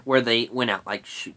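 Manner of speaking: ordinary delivery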